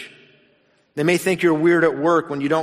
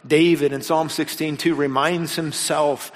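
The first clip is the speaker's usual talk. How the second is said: ordinary talk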